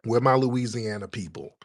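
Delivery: ordinary talk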